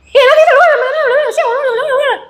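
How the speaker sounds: mock machine-gun fast speech; very fast Ambonese talk. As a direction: blurt it out in one high, hurried burst. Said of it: "Cepet sekali ngomongnya".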